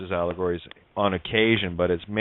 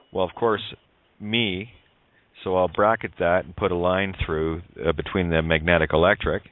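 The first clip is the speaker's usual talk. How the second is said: ordinary talk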